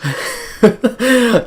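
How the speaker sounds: loud high-pitched laugh; cracking up at the joke; high burst of laughter. Real, not acted. Laughing at paint huffer line.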